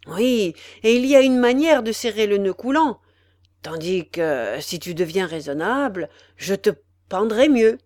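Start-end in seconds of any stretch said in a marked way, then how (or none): none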